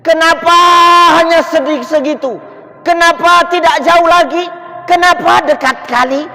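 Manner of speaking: high, loud, repeated anguished cries; Sya'ban crying out on his deathbed, high and loud; dying man's scream. Retold or imitated. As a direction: scream each why out. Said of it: "dia menjerit".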